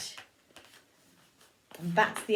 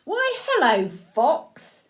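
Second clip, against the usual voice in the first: high, lilting storybook hello; sing-song mouse, playfully friendly; cheery mouse greeting; 6 semitones higher, 5 dB louder, slower than usual. A narrator's reading. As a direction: greet them with a big swoop up and down.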